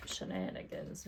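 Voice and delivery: whisper. Real, not acted. Whispered aside while picking sticker.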